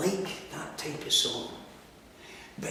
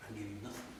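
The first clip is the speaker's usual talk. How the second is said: faint and low; 8 semitones lower, 19 dB quieter, faster than usual. Acted. Alone and lost after his death.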